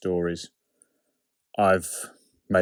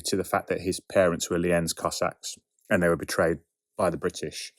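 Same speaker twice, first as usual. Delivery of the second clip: usual speaking voice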